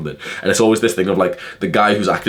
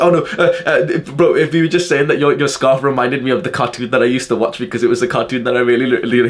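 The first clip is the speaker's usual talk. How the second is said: nervous stutter; 6 semitones higher than usual. Retold or imitated. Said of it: "he starts stuttering his words".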